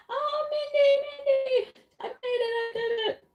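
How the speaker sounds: high-pitched mock happy crying; Maritza tearful and happy. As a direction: imitate a friend squealing through happy tears. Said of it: "like, teary. She was, like, crying, emotional".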